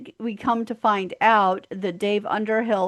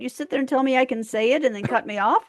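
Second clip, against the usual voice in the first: raised, indignant mock rant; mock outrage; 6 semitones higher, 4 dB louder than usual. Real, not acted. To her co-host, an indignant mock rant. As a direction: play up the huff, loud then fast. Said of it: "I'm having a shit fit".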